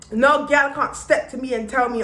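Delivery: ordinary talk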